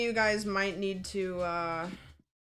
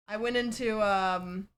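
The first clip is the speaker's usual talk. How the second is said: ordinary talk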